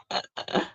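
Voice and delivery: soft, voiceless little chuckle; low-key real laugh; quiet chuckle. Real, not acted. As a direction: chuckle under the breath. Own chuckle after joking remark.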